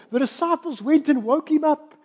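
usual speaking voice

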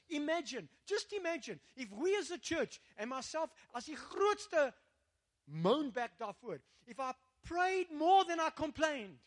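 high, forceful preacher's appeal; impassioned preaching. Real, not acted. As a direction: urge the congregation to imagine it.

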